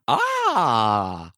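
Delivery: long, held "aaah" as a sound effect. Shown as a demonstration. Co-host rating the fan theory with a sound effect, held "ah". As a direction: put on a drawn-out "ah". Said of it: "give it a sound effect".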